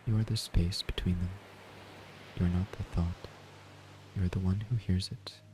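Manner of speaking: ordinary talk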